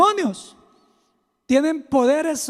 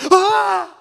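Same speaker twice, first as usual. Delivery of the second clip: startled gasp; 6 semitones higher, 6 dB louder than usual. Acted. Pedro frightened by the waves.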